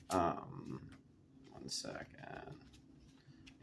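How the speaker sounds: low, soft murmur to self; quiet aside. Real, not acted. Speaker stalls viewers while finding a graphic, quiet aside. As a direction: drop the voice while searching. Searching for the graphic mid-talk.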